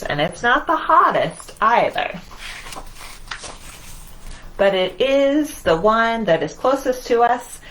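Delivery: lively picture-book read-aloud; reading to children. Acted. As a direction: read it with wonder, as if to a circle of little kids.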